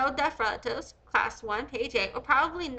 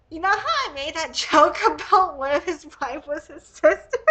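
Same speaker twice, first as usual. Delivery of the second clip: laughing; 3 semitones higher, 8 dB louder than usual. Real, not acted. Laughing about her own joke.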